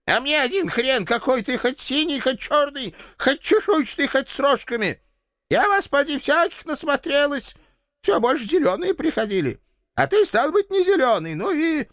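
a stubborn granny waving off the demon; high old woman's voice, mocking "не зелёный"; old landlady. Landlady threatens with gun.

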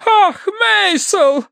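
very high, slow wail of a name; shrieking wife. A narrator's reading. Hysterical wife calls her husband again, shrieking. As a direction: wail theatrically for rescue. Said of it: "верещала миссис Меринг".

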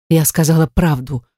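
ordinary delivery

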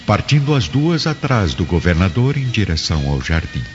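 ordinary delivery

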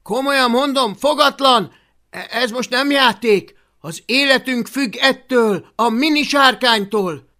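loud, high urgent scolding; desperate shouting. Acted. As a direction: shout as if lives depend on it. Desperate Hablaty scolding the dragon.

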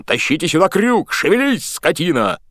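loud, high-pitched bullying roar; yelling boatswain. A narrator's reading. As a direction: drive the sailors with a shout. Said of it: "орал он". (боцман кричит на матросов)

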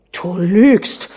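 loud burst of fury; angry accusation. A narrator's reading. Prince calls his wife a liar, furious. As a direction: throw the charge in her face.

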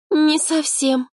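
high, slow, timid little voice; timid babble. A narrator's reading. Girl admits she can barely cook, timid. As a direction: falter it out in a small high voice. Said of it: "пролепетала она".

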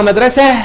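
مدرسة as madrasah with a breathed H; final h, no t. Shown as a demonstration. Teacher explaining why it is written with ha, H-ending مدرسة. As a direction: end مدرسة on H instead of T. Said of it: "أقدر أنطقها مدرسة".

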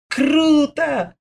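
put-on high, thrilled squeal of a boy; bursting with excitement; breathless delight. A narrator's reading. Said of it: "чуть не задохнулся от восторга".

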